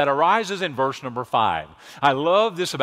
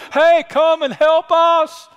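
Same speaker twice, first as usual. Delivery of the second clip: slow, much higher, loud call to the partners; 13 semitones higher, 8 dB louder, slower than usual. Retold or imitated. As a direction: shout across the water to the other boat. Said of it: "Peter began to shout out to his partners".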